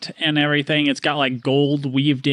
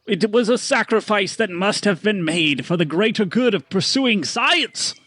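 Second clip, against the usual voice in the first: higher showman voice, hedging; sheepish merchant; 6 semitones higher, slower than usual. Acted. Stanley squirming under the snake question. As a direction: dodge the question with a nervous grin.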